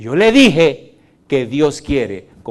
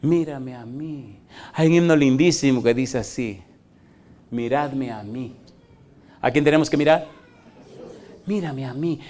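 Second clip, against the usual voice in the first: soft call; 6 dB quieter than usual. Retold or imitated. Pastor voicing God's call softly to the church. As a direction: let God's call fall gently. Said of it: "dice Dios".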